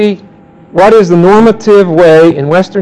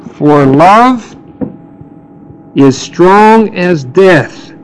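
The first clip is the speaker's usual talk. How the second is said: slow scripture reading; much slower than usual. Shown as a demonstration. Reading the climax verse.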